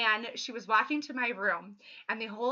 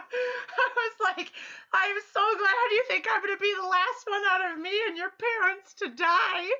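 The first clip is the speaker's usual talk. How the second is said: high, loud, laughing-crying speech; real helpless laughter through words; 10 semitones higher, 6 dB louder than usual. Real, not acted. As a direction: barely hold it together while laughing. Laughing while retelling reply.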